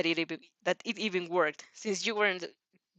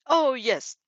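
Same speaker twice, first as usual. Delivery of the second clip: bright beaming reply, higher and louder; 5 semitones higher, 6 dB louder than usual. A narrator's reading. Principal answering Athena, beaming. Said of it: "he beamed".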